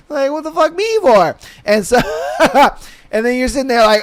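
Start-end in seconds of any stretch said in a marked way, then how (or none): 0.3-1.4 baffled. Retold or imitated. Voicing baffled recipient.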